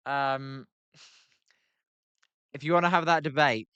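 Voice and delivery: ordinary talk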